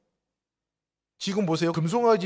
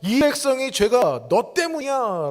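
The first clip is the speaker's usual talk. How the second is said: slow, higher accusing voice of God; God blaming; 6 semitones higher, 3 dB louder, slower than usual. Retold or imitated. God pinning the people's sin on Moses, slow and accusing. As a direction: drag out the blame slowly. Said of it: "하나님이 뭐라고 말씀하셨냐면".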